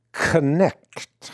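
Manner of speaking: Knecht said with a Dutch accent, hard k sounded; Dutch brogue. Shown as a demonstration. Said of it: "I'm trying to say it in my Dutch brogue".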